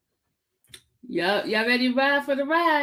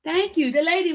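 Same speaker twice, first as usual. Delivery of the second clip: normal talking voice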